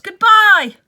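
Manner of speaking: loud high call of goodbye; chorused farewell. Acted. Animals waving Boxer off, loud calling farewell. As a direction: call out cheerfully to the van. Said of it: "they chorused".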